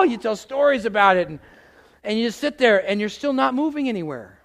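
usual speaking voice

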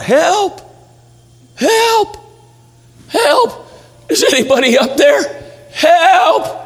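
high-pitched mock cries for help; crying out for help. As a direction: call upward, high and drawn out. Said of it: "He started crying out".

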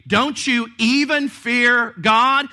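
EVEN pushed up high and loud, said slowly; rebuking shout. Retold or imitated. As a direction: demand it, stressing EVEN. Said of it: "Literally, it could read".

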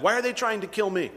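whining. Retold or imitated. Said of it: "kind of a little bit whining".

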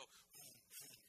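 high squeaky imitation of a car revving; mock engine noise of a Camaro. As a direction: mimic a wimpy engine rev, high and thin. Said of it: "they go".

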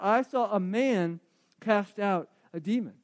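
ordinary delivery

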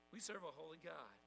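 ordinary delivery

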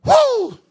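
loud, high rodeo whoop with a laugh; excited whoop. Real, not acted. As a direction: let out a cowboy whoop. Whoops about bull riding.

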